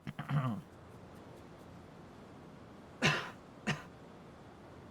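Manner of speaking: coughing fit. Real, not acted. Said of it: "My voice is gone".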